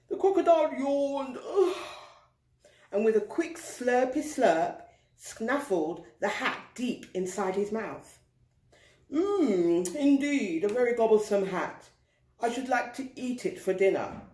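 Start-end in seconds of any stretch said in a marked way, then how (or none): 0.1-1.8 yawning. A narrator's reading. Narrating a crocodile yawn. 9.1-14.2 lightly voiced greedy crocodile; a hint of a hungry croc; drawling crocodile. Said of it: "drawled the crocodile".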